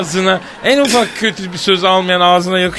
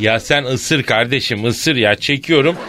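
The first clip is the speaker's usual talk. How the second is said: ordinary delivery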